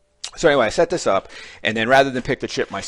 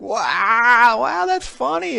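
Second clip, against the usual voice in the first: squeaky, very high giggly wow; a high, cartoonish laughing voice; laughing student; 7 dB louder, much slower than usual. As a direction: mimic a student who finds it hilarious. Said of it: "They'd be like".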